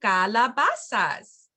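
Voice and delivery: "las", pause, "calabazas", slowly; slow for repeating. Shown as a demonstration. Teacher inviting parents to repeat, slow word by word. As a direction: break "las calabazas" apart for learners to echo. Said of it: "if you'd like to repeat".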